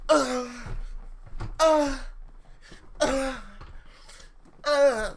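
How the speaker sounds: high, loud moaning grunts; hammed-up moaning with each blow; mock sexual grunts. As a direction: separate exaggerated "uh" moans, high up. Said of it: "That's what I imagine beating somebody up sexually".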